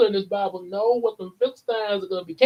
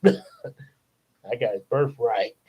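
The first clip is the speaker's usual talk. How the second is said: low, slow sly gloat with a chuckle; gloating Jacob; 7 semitones lower, 3 dB quieter, much slower than usual. Acted. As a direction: chuckle low over your winnings. Acts Jacob gloating after the trade.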